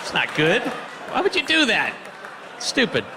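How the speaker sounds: frantic, dazed addict shocked at the clock; high junkie. Pretend. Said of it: "here's my impression of being on heroin".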